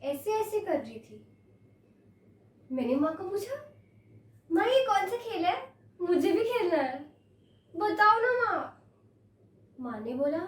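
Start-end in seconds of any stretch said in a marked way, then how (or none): 4.5-8.6 put-on slightly raised, coaxing child's plea; a little girl's eager wheedling; pleading child. Child asking mother to share her game, pleading.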